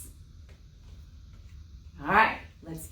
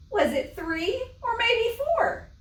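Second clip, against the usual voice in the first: much higher, desperate wail; 3 dB louder than usual. Acted. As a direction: wail as if all is lost. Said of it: "she wailed".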